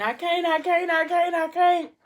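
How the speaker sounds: high, loud wailing protest; acted-out holler in labour, pitch way up. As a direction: cry it out in desperate refusal. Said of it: "She hollering, she can't".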